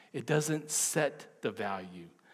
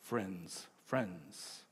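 fading softly; 7 semitones lower, 10 dB quieter than usual. Real, not acted. Emphatic repeat fading.

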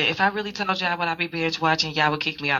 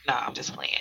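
hushed teasing whisper; 5 semitones lower, 9 dB quieter, faster than usual. Real, not acted. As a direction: whisper it like a secret aside. Playful joke aside to listeners.

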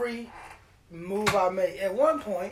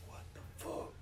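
frightened whisper, no voice at all; 16 dB quieter than usual. Retold or imitated. Hiding in the closet from the intruder, whispering. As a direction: breathe it out so he can't hear you.

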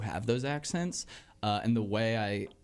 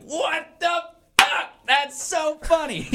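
high, loud, slow hammed-up shriek; mock hysterics; 7 dB louder, slower than usual. Old couple die at waiter's full name, imitated shrieking hysterics. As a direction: overplay uproarious amazement. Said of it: "both of them just die".

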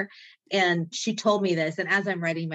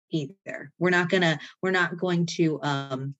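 ordinary talk

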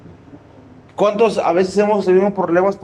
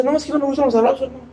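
fast, dismissive refusal; mock brush-off; 3 semitones higher, much faster than usual. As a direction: rattle off the excuse quickly. Imita al que rechaza el abrazo. (rechazo rápido y desdeñoso)

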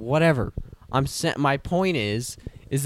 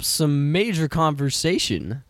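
podcast intro; 4 semitones higher than usual. Acted. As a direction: welcome listeners back with energy. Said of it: "we didn't do the intro".

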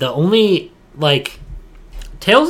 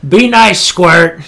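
high, loud mocking quote; a loud teasing quote of the line; mock character line; 5 semitones higher, 8 dB louder, much slower than usual. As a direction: fling the line back like the character.

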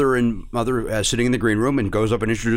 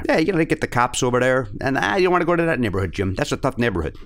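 put-on Chicago local patter; Chicago accent. Local warns Jim about a tough neighborhood, thick Chicago accent. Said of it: "You put it back on very well".

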